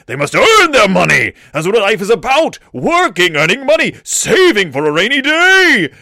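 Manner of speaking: high, loud ranting father; yelling. Acted. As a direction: shout it like an outraged dad. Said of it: "and he's yelling".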